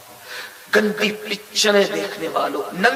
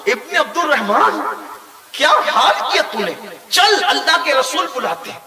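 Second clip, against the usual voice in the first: higher and louder, laughing as he calls out; eager call; 6 semitones higher, 3 dB louder than usual. Acted. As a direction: call the lost friend, relieved and glad. Companions find and call him.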